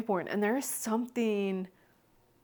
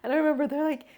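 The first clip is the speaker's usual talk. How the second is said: ordinary talk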